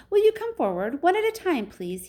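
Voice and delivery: loud, high fluty call; high, fluty storybook showman. A narrator's reading. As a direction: call them forward in a high, piping voice. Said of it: "His voice was high and fluty".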